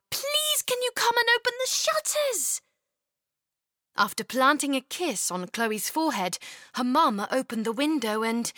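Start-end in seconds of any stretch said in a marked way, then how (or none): 0.1-2.6 put-on high, quick little-girl plea; young girl pleading. Chloe asking her mum, high and eager. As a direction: wheedle mum into helping.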